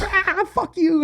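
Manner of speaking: put-on high, drawn-out French-accented retort; imitated French woman. French tour manager cursing him back, imitated French accent. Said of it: "And she was like".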